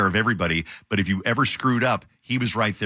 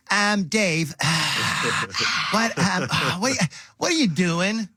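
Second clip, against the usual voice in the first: high-pitched, sighing, halting questions; hovering boss; 9 semitones higher, slower than usual. Acted. Host mimics program directors who breathe down your neck, exasperated. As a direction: play a fussing boss with exasperated sighs.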